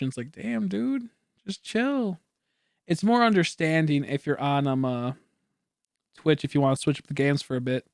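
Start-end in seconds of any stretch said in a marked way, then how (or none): 0.3-2.2 high, soft dismissive quote; exasperated brush-off. Retold or imitated. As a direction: wave off the haters lightly. Quoting what he thinks of annoyed viewers.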